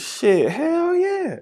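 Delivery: a neighbor savoring the thought; drawn-out high hell yeah. Acted. Neighbor gleefully drawls about snitching for the bounty. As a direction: stretch it out slow and high.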